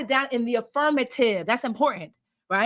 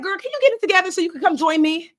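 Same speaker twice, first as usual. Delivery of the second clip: annoyed future self; 7 semitones higher, 4 dB louder than usual. Acted. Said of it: "She's like".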